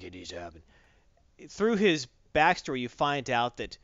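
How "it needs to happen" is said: low, quiet hero voice; terse, low vigilante impression; Batman. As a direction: drop into a mock-dark, hushed register. Batman answering Gordon.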